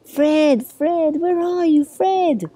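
high searching call; drawn-out high calls of a searching cat. A narrator's reading. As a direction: call for a lost friend, high-pitched. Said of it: "looking for Sid and Fred and calling".

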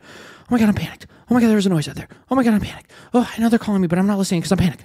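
panicked dog voice. Retold or imitated.

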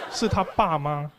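normal talking voice

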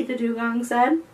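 ordinary delivery

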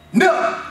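"Never" hit high and loud on its own; shouted single word. Acted. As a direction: fire the word "Never" like a verdict. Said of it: "you'll never control your money".

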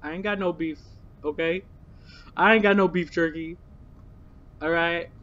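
ordinary talk

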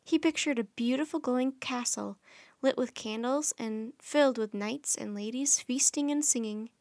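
ordinary delivery

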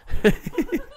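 sudden high-pitched laugh. Real, not acted. Streamer laughing at a chat comment, high laugh.

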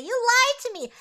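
high, loud mock accusation; hurt accusation. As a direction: snap it back at the liar. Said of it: "And Lydia's like".

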